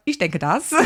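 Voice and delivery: mock quote of his German with a lilt; playful imitation of his odd German rhythm; imitating his German. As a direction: echo how he said the sentence in German. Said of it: "the pronunciation is correct. It's just, like, the way you said that sentence".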